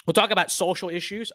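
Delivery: normal talking voice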